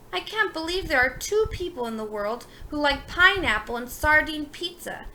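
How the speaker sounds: plain reading voice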